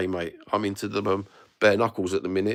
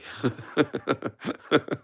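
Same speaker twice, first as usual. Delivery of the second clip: breathy laugh; 6 semitones higher than usual. Real, not acted. Laughs at own joke.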